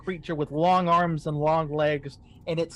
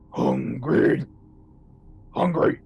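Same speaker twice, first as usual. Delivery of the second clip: a hint of a creepy monster, breathy; ghostly repeated hunger; 5 semitones lower, 4 dB louder, much slower than usual. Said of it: "You hear something say".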